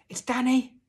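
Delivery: very high, loud call; shouting. A narrator's reading. Boy telling his lost father who is there, shouting. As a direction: call your name across a wood. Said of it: "I shouted".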